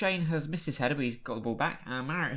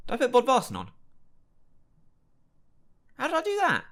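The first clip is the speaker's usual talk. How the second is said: shocked disbelief; 11 semitones higher, 5 dB louder, faster than usual. Real, not acted. Shocked by own mistake.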